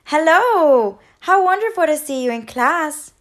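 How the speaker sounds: high, loud, delighted greeting; cheerful chirp. A narrator's reading. Bird teacher welcoming her returning pupil, chirping cheerfully. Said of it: "she chirped".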